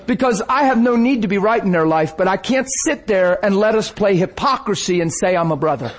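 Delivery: ordinary delivery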